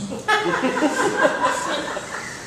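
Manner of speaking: high breathy burst of laughter; amused giggle. Acted. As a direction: let a laugh escape mid-story. Narrator cracks up at own line.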